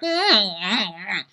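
high wordless mock hums; shrugging hum. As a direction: hum a high noncommittal response. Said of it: "she just shrugs like".

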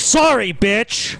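mock rude Canadian. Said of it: "In Canada, like".

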